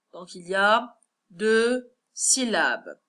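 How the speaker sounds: usual speaking voice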